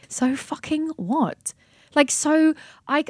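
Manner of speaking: high, slow, exasperated outburst; real exasperation. Real, not acted. Ranting to her co-host about people avoiding the grieving, exasperated. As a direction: let the frustration spill out, drawn out. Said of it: "you can see I'm getting so angry".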